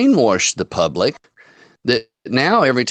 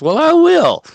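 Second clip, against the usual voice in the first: much higher, louder mock-indignant girl voice; defiant comeback; 6 dB louder than usual. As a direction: imitate her huffy retort. Cashier defiantly answers back.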